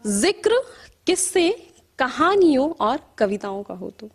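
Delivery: measured verse with rising and falling cadence; poetry recital. Acted. Poet reciting to an appreciative audience, measured poetry recital. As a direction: recite in lilting lines, letting line endings sink.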